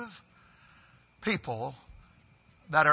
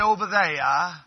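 Maine "there" stretched into "they-uh"; Maine accent; slower than usual. Shown as a demonstration. Preacher demonstrating Maine speech to the congregation, Maine accent. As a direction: drop the r like a Mainer. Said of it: "They say".

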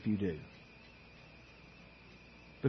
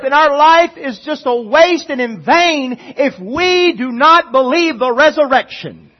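higher, louder pleading sermon voice; preaching at full force; 10 semitones higher, 13 dB louder, slower than usual. Acted. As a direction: plead with the congregation from the pulpit, urgent and loud. Preaching on the resurrection.

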